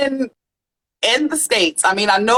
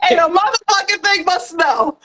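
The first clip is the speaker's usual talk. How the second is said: cracking up mid-sentence; giggling at the thought of Alaska; laughing; 10 semitones higher than usual. Real, not acted. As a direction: talk through real laughter. Joking about Alaska.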